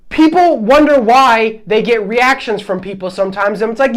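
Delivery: ordinary delivery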